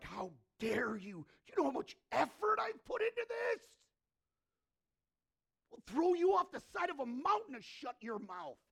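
loud, high outraged yelling; acted furious outburst, pitched high; angry. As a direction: roar back in mock outrage. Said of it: "They were angry".

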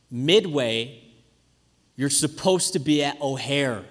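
low-pitched, measured disbelief, pausing after "Midway"; retold deadpan realisation. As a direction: let dad's correction land slowly and low. Dad's deadpan reply after long pause.

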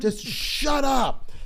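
slow, high, loud "Just shut up"; retold angry shout. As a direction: drag it out in mock fury. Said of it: "'Cause I was so angry".

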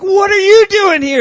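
a student bursting with excitement; excited fan shout. Retold or imitated. Fan excited to meet star.

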